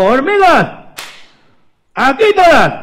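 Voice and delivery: high, loud summons to the troops; commanding high shout; boss ant's rallying call. Acted. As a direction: call everyone over, drawn out. Boss ant summons the others.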